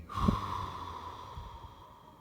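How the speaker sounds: long, audible exhale that slowly fades; big sigh. Shown as a demonstration. Meditation guide showing listeners how to let go, big sigh. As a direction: let it all go in one big breathy sigh. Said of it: "letting go with a big sigh".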